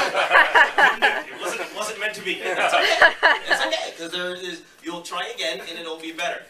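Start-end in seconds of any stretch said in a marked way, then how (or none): none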